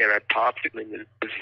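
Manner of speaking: ordinary talk, nothing marked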